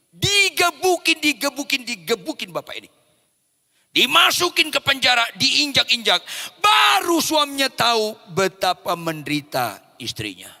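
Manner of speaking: loud, high bursts of preaching; forceful preaching. Acted. Preacher telling of husband being beaten, loud forceful preaching. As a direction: hammer out each blow, then ease down.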